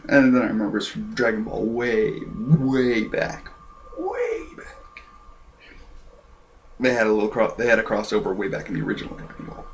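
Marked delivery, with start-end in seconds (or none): 4.0-5.0 soft, high, airy whisper; thin faraway falsetto whisper; distant echo. Shown as a demonstration. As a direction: echo it faintly as from long ago. Echoes own words for effect.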